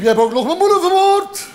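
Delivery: high, climbing mock wail; cried out. Fourth ape shouting words meaningless to him, high cry. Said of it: "de vierde riep".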